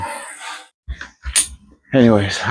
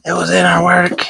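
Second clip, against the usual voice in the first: high, mock-panicked wife voice; imitating his panicked wife, pitched up high; freaked-out wife; 9 semitones higher than usual. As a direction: squeak it out like a frightened wife. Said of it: "She freaked the hell out".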